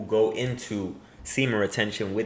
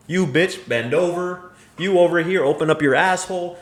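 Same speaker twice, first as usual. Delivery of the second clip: mock commanding shout; 5 semitones higher, 4 dB louder than usual. Mocking the fantasy guy's commands.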